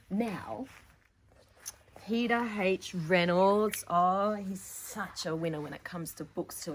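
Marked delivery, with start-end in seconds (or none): none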